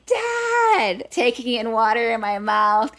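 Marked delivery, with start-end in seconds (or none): 0.1-1.1 high, loud retold scream of a drowning child; screaming for dad. Girl remembering calling to her dad, high panicked scream. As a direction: cry out for your father across the water.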